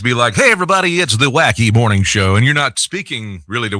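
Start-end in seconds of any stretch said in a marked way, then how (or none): 0.3-2.3 hammed-up, high-pitched radio greeting; wacky morning DJ. Radio host mocking impersonal broadcast greetings, wacky DJ voice. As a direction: put on a cheesy FM DJ greeting. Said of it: "cheesy F M D J".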